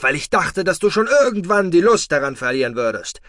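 loud, high furious verdict; angry ultimatum. Acted. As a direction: hand down the punishment. Foster father's final angry verdict.

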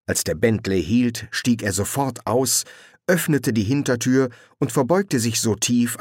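ordinary delivery

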